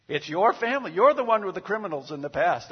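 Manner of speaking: "your" hit loud and high. Acted. Parent blaming the other parent, "your" shouted. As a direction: raise the voice and jab the word "your". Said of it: "when the kid screws up".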